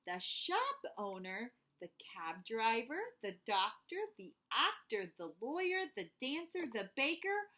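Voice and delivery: put-on gentle read-aloud for kids; storybook narration. A narrator's reading. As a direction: tell it like a bedtime story. Reading picture book to children.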